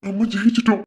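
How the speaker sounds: high and loud, bursting with joy; husband shouting the tender win to his wife; thrilled. Acted.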